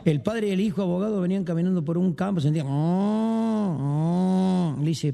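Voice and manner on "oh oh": long, drawn-out moo; imitated cattle moo. Father and son walking through a field, imitated mooing. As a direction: moo like cows across a field.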